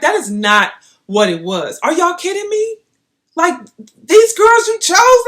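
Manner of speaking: high, loud, incredulous rant; furious disbelief. Retold or imitated. Confronting the rep over the girls they chose, loud and outraged. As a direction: demand an answer, outraged.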